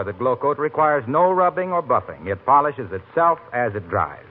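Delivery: ordinary delivery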